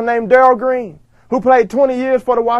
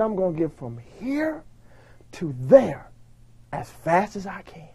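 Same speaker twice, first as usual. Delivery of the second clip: quiet resolve; 5 semitones lower, 11 dB quieter than usual. Retold or imitated. His quiet resolve at the start line.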